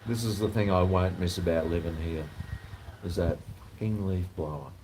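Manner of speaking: normal talking voice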